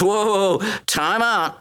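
high startled protest, slowing down; mock Judas hitting the brakes; alarmed Judas. Judas hearing Jesus will die, startled. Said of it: "Judas goes".

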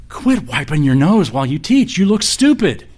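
exasperated wife. Retold or imitated. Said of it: "one of the students' wives said".

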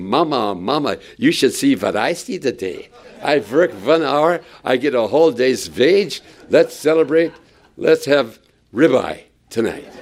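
put-on excited Yiddish-tinged accent, v for w; a gleeful worker bragging in a Yiddish accent; mock Yiddish-flavored worker. Worker bursting in telling Mama, mock Yiddish accent.

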